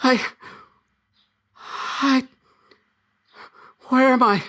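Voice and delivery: a lost spirit suddenly afraid; panicked ghost voice. Acted. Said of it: "like my ghost voice?".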